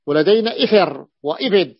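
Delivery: ordinary talk, nothing marked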